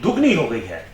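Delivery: usual speaking voice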